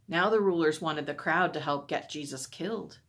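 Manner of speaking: usual speaking voice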